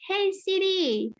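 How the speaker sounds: high, slow call with a falling end. Shown as a demonstration. Teacher demonstrates the example to students, calling out. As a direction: stretch it out to hail someone.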